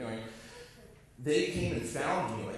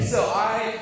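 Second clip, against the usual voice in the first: ordinary delivery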